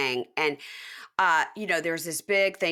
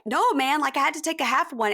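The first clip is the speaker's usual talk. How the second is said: higher-pitched imitation of a defensive guy; mock protest; 8 semitones higher, 3 dB louder than usual. Retold protest in recap.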